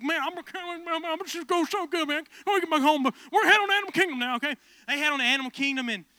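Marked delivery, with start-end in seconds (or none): 0.0-4.6 mimicking the dad. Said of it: "he's just like".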